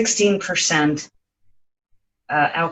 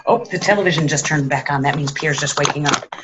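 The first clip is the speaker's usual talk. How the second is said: ordinary talk, nothing marked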